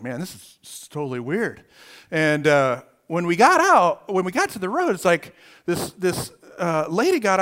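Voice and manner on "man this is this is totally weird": hushed puzzlement. Retold or imitated. Quietly reacting to parked car.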